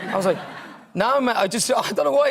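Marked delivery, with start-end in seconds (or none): 0.9-1.4 put-on English accent, pitched higher. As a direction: deny it in a mock English accent. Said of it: "I put on an English accent".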